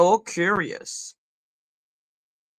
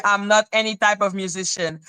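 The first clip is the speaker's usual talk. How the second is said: ordinary delivery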